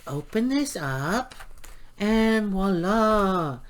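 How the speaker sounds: slow, drawn-out voila; excited reveal. Real, not acted. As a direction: present it like a magic trick. Big reveal of the journal.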